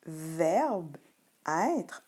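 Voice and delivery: very slow "verbe, être" with endings left mute; silent final E. Shown as a demonstration. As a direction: draw out "verbe, être" word by word. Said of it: "on n'entend pas le E".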